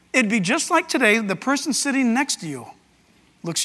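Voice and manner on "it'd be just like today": ordinary delivery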